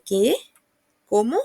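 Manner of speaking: "¿Qué?" and "¿Cómo?" swept upward; rising question tone. Shown as a demonstration. Teacher showing how questions lift the voice, rising tone. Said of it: "Donde sube la voz".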